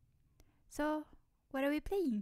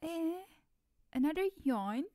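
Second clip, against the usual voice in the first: surprised; 5 semitones higher, 4 dB louder than usual. Acted. Friend yawns again.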